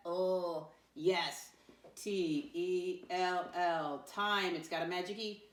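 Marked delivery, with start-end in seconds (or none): 2.0-4.1 slow, separated letters; spelling out. Shown as a demonstration. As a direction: call out each letter slowly for the class. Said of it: "Tell me how to spell tell".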